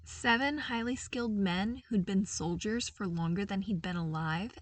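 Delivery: usual speaking voice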